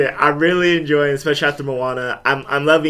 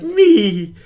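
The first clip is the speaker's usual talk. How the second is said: vain mirror voice; 9 semitones higher, 4 dB louder than usual. Retold or imitated. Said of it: "she's like".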